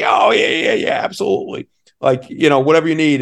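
high, loud imitation of an obliging coach; retold eager agreement. Coach promising tickets to kid's mom, eagerly agreeing. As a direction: act out falling over yourself to say yes.

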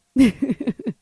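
laughing. Real, not acted. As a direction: laugh after landing the punchline. Laughs at the punchline.

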